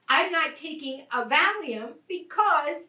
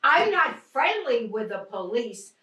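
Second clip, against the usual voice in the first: usual speaking voice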